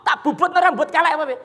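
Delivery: loud, fast, hammed-up indignation; defiant debtor. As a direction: act out a debtor squaring up to the lender. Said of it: "Ambek malang kerik ngene".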